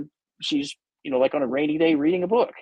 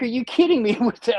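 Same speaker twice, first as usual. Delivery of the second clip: incredulous; 6 semitones higher than usual. Retold or imitated. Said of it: "I'm like".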